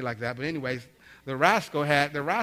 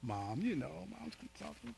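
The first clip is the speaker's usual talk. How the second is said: mock crying; 6 semitones higher, 12 dB quieter, slower than usual. Son answers dad asking why he cries, mock tearful mumble. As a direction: imitate a son blubbering, trailing high and very soft. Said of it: "So why are you crying?".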